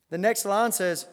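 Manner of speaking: ordinary delivery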